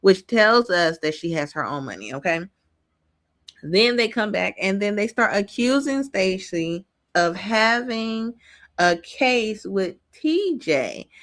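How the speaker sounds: usual speaking voice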